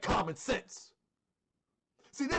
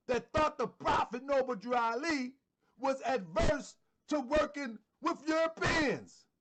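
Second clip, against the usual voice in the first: normal talking voice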